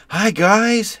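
high, loud bright hello to the crew; cheery greeting. Retold or imitated. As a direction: call out a big happy hi as you walk in. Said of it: "She's gonna say".

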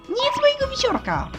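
high-pitched, urgent protest; alarmed exclamation. Acted. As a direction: cry out to stop someone eating your things. Scolding in an acted scene.

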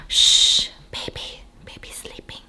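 hushed whisper, imitating a toddler; whispering over a crib. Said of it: "she'll just randomly be like".

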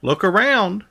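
ordinary delivery

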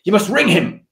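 high, insistent mock command; retold urgent order. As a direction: imitate an alarmed boss giving orders. Retold boss ordering an apology.

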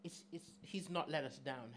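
normal talking voice

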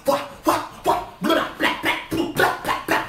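loud mouth gunfire blah blah; spray imitated shots fast and loud; gunfire noises. Acting out the gunfight.

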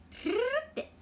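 トゥルルル with a rolled r trill. Shown as a demonstration.

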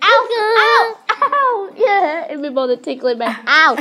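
sharp high cries of pain and laughter; loud yelps. Real, not acted. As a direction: yelp while being tickled. Sister being tickled, crying out.

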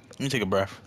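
ordinary talk, nothing marked

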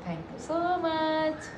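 high, stretched-out sing-song thank-you; slow, lilting, high-pitched gratitude; effusive thanks. Real, not acted. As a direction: draw the words out gushingly.

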